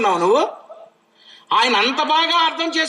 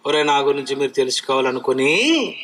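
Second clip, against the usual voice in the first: voicing God; 12 semitones lower than usual. Preacher voices God speaking.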